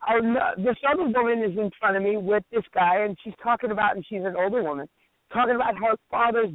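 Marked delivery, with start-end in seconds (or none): none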